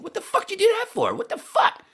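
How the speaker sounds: high, fast, loud shocked cry; shocked girls. Retold or imitated. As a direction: react in outrage and disbelief. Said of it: "everybody's going".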